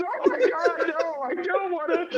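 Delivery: put-on high, wailing little girl refusal; freaking out. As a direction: recoil in a high, whiny child voice. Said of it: "she is just freaking out".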